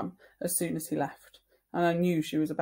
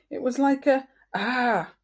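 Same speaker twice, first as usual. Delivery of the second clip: mock scream; 7 semitones higher, 7 dB louder than usual. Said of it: "argued Jamie".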